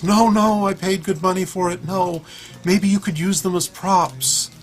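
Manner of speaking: high, loud mock-mom voice; imitated protesting mom.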